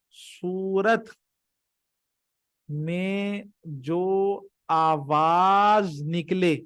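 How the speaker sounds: very slow, drawn-out words with pauses; teacher spelling out a definition at dictation speed. Shown as a demonstration. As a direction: dictate it slowly, stretching each word.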